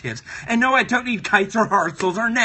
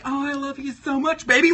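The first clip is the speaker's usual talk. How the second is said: higher, gushing lovey-dovey heart; cutesy talking heart; 6 semitones higher, 4 dB louder than usual. Acted. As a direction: gush like a lovestruck cartoon heart. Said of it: "It's like".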